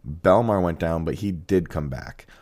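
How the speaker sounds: ordinary talk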